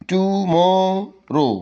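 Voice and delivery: "Tomorrow" high and stretched, tu... mo... ro; broken into syllables. Shown as a demonstration. Teacher showing students the method, "tomorrow" slowly syllable by syllable. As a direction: draw out each syllable of "tomorrow". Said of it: "এইভাবে".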